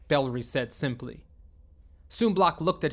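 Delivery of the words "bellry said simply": usual speaking voice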